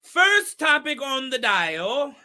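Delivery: measured imitation of Shaba's accent, word by word. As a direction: declare it emphatically in Shaba's accent. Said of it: "keep this accent all night".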